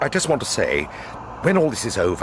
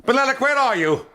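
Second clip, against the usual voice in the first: calling out. Acted. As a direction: shout to flush him out. Calling into a dark gallery.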